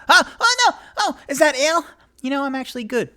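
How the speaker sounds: very high, frantic character voice; high-pitched flailing panic easing off; panicked Onyx. Acted. Onyx flailing and splashing in ale.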